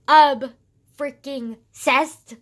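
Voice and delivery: Ob-freaking-cessed stretched slow and loud; emphatic gush. Real, not acted. Teen gushing about drag, Ob-freaking-cessed shouted slowly.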